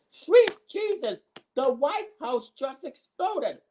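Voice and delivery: passionate commentator. Pretend. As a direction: announce the explosion like a shocked ringside commentator. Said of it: "commentator with passion".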